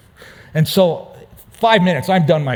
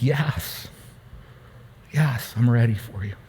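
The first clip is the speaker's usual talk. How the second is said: softer, choked-up quiet yes; emotional surrender; 3 semitones lower, 6 dB quieter than usual. Real, not acted. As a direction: let the emotion catch as you say yes. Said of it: "Those emotions still come up".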